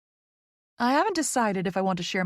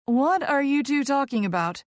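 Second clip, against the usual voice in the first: higher-pitched puzzled question; clearly confused; 6 semitones higher, 3 dB louder than usual. A narrator's reading. Vicky left out of the conversation, confused. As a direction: ask it, baffled. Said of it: "clearly confused".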